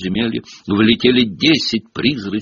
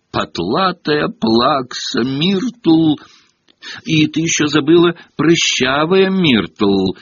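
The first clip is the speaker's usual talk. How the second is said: a higher, slow taunting voice; Peeves hissing; 8 semitones higher, slower than usual. A narrator's reading. Peeves hissing taunts into Myrtle's ear, higher. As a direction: hiss the insult gleefully. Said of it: "прошипел ей в ухо Пивз".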